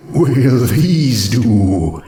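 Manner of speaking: very slow ghostly question; drawn-out shy ghost. Acted. Ghost offering coins to Rarity, very slow.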